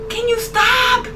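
high, loud startled plea; alarmed protest. Real, not acted. Player pleading with the horror game, high and alarmed. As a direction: cry out in alarm.

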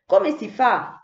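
ordinary delivery, nothing marked

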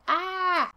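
sudden high mock yelp; a quick high shriek of fright; startled yelp. Reader reacting to tendrils, high startled yelp.

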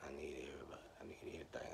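whispered God. Retold or imitated. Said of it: "He said".

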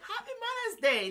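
excited squeal. Retold or imitated. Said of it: "I'm like".

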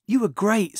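high, loud, thrilled praise; excited congratulations. A narrator's reading. Friend congratulates the actor at the bar, excited. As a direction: burst out with admiration.

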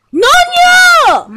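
high, drawn-out cry of protest; high-pitched, slow outburst; indignant cry. Acted. Scolding the others in a skit.

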